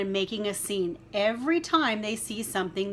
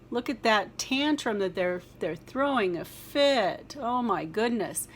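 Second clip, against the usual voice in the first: ordinary delivery, nothing marked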